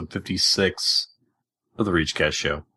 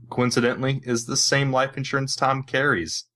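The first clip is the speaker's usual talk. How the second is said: normal talking voice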